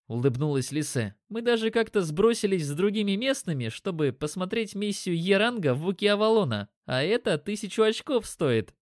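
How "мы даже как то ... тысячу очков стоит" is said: higher-pitched, lightly voiced elf woman; chatty elf woman. A narrator's reading. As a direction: share it as a villager in a raised voice.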